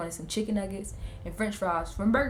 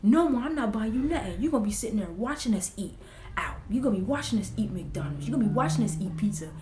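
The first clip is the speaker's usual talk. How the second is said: mock angry dad; 4 semitones higher than usual. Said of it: "He was like".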